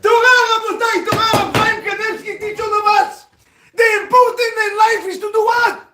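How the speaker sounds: high, loud exhortation; roaring sermon climax, pitched high; preaching at full force. Real, not acted. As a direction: shout it out to the congregation.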